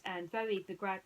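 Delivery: ordinary delivery, nothing marked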